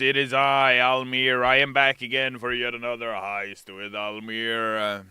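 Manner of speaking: loud, high announcer greeting; hyped stream intro. Acted. Host greeting his heisters, loud and hyped. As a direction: boom it out to the viewers.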